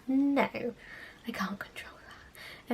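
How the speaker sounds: hushed, slowed-down whisper; whispered aside. Real, not acted. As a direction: breathe it out as a private aside. Muttered aside to the audience.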